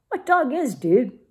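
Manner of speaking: quip. Acted. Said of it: "quipped Don Diego".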